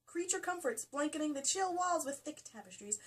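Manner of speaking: high, lilting storybook read; hammed-up wonder; mock-impressed reading. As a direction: read it as if dazzled. Reads in a mock posh excited voice.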